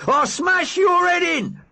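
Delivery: slow, high, roared threat; furious shout. Acted. Big Mac roaring at the driver, furious slow shout. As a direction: bellow it out like a maniac. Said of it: "Big Mac attacks".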